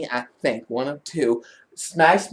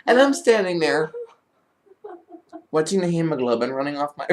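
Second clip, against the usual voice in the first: ordinary talk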